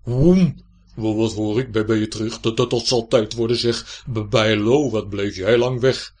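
loud, booming, stammering grumble; startled old knight, scolding and stuttering; armour's voice. A narrator's reading. Armour thinks its page is back, loud stammering complaint. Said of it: "sprak het harnas".

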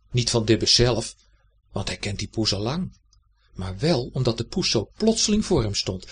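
ordinary delivery, nothing marked

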